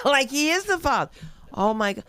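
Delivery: high, loud comic shriek; hammed-up shrieking alarm; mock horror. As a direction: cry out as if appalled. Imagining the horrified reaction to baby.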